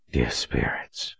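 low, breathy and hushed; breathed. Acted. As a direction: breathe it out in shock. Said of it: "he breathed".

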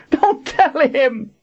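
slow, very high agonized scream. A narrator's reading. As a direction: scream the warning, stretching each word. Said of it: "Kara screamed".

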